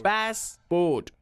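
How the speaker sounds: passport split into two stretched syllables; pronunciation drill. Shown as a demonstration. Trainer leading pronunciation exercise, passport drawn out and exact. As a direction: stretch both halves of passport.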